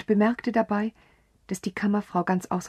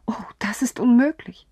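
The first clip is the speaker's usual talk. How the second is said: louder, higher cry of denial; exclaiming; 5 semitones higher, 5 dB louder than usual. A narrator's reading. Countess crying out her denial of the truth. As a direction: cry it out, brushing it off. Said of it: "rief sie".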